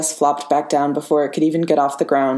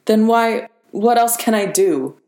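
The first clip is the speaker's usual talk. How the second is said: voice cracking; 6 semitones higher than usual. A narrator's reading. Sam cracking with despair as he argues with Peter, voice breaking. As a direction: let the voice fracture under furious despair. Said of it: "hairline fracture in Sam's voice".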